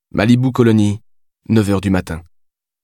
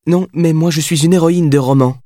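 higher, playful and teasing; mischievous; 8 semitones higher, 4 dB louder than usual. A narrator's reading. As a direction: toss it back with mischief. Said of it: "répondit-elle avec malice".

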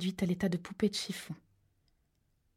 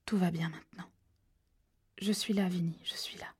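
doux et bas, qui finit en chuchotement; apaise-le tout doucement; rassurant; 5 dB quieter than usual. A narrator's reading. Sal rassure son frère mourant. (Sal rassure son frère mourant, à voix douce)

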